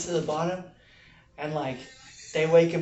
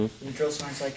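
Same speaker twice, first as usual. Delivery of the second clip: usual speaking voice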